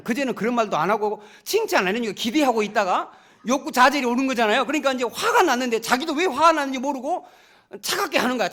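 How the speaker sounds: ordinary talk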